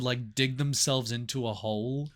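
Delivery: normal talking voice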